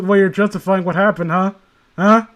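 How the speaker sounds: normal talking voice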